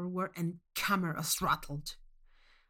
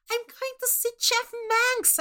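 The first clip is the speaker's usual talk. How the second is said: much higher, giddy squeal; squealing excitement; 4 dB louder than usual. Acted. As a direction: squeal it like a thrilled preteen.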